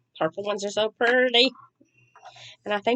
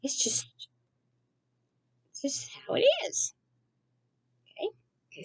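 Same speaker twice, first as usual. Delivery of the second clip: whispered aside; 11 semitones higher, 8 dB quieter than usual. Real, not acted. Wry aside to the viewers.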